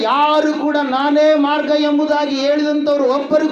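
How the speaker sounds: high, emphatic preacher's voice; full-force preaching. Acted. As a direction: declare it with total conviction.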